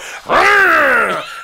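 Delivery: high, loud cry of being dazzled; mock yell. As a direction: yell out as if blinded. Acts Bond's yell on being dazzled.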